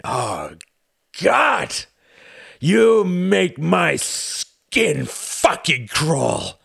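swings from shout to cold hiss; disgusted villain with a gun; raging count. Acted. Count Orski raging, gun in hand.

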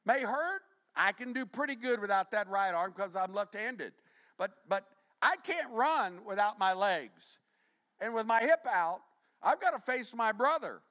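high, loud impassioned preaching; preaching at full force. Acted. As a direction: drive it home with fire.

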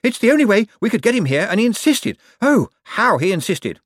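flustered high-pitched outburst; panting. A narrator's reading. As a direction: complain while out of puff. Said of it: "panted Hicks".

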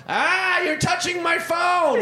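high, loud whiny yell of the thief; mock shout. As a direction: yell it like a caught thief protesting. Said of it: "and he goes".

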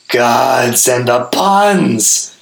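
high, loud, drawn-out groan of a shout; exasperated scream. A narrator's reading. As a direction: howl it in childish angst. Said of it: "Gaz screamed".

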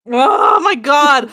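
slow, loud drawn-out exclamation; excited shout. Real, not acted. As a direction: belt it out slowly with glee. Excited at topic announcement.

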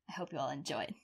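a soft whisper to close the episode; whispered sign-off. Real, not acted. Host signing off to listeners, whispered. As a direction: breathe it out, barely voiced.

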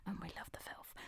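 whispered aside, breathy and hushed. Real, not acted. Podcast host whispering a naughty aside to listeners. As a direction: lean into the mic and whisper it.

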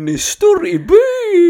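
excited high-pitched ribbing; teasing high. Real, not acted. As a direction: rib him about his stare. Teasing about the stare.

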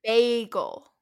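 bagel with a long AY, as in BAY; first vowel of bagel a clear "ay"; corrected pronunciation. Shown as a demonstration. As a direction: say bagel as if spelt BAY-gel. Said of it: "as if it was B A Y".

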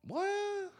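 high squeaky disbelief; shocked squeal. Real, not acted. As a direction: jump way up in pitch. Shocked reaction.

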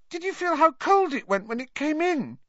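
very high-pitched, rattled character voice. A narrator's reading. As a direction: push the voice far up high. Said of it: "in a higher voice than usual".

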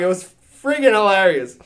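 ordinary talk, nothing marked

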